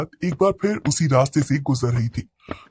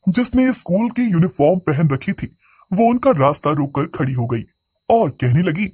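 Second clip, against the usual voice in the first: ordinary delivery, nothing marked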